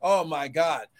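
ordinary delivery

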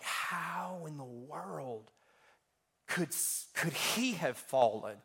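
slow, hushed mock disbelief; stunned disbelief. Preacher relives learning his role model sinned, stunned and slow. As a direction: draw it out, softly incredulous. His shocked reaction to mentor's sin.

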